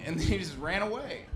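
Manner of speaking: usual speaking voice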